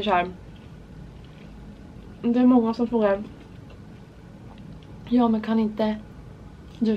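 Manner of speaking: talking while eating snack; speaking through fingers; hand over mouth. Real, not acted. Seen 3: Fingers and snack at lips 0.1–1.9s, then hand drops.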